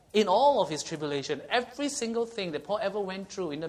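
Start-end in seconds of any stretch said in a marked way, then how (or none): 0.1-1.4 "all" pressed harder and louder than the words round it; stress on all. Shown as a demonstration. Preacher stressing "all" to the congregation, emphatic. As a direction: lean hard on "all". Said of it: "I want to emphasize the word all".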